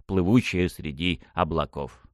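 plain reading voice

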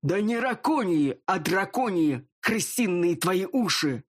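slow angry bark at a subordinate. Acted. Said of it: "рявкнул".

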